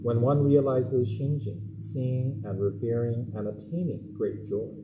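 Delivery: low, measured reading of scripture; solemn scripture reading. Acted. Minister reads Shinran Shonin's words to the adults, low and measured. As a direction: read it slowly with reverence.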